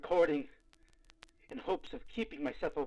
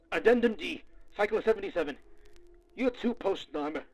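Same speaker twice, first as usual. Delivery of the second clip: frantic log entry; 5 semitones higher, 6 dB louder than usual. Acted.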